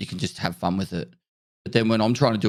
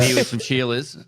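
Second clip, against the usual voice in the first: mock Aussie; 5 semitones higher than usual. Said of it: "Aussie lingo".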